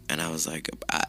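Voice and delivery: ordinary talk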